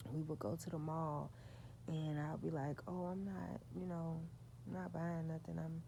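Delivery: normal talking voice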